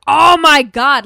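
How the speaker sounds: loud, drawn-out awe; big emphatic burst of admiration; emphatic gushing. Real, not acted. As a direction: belt it out, bowled over. Gushing over the character.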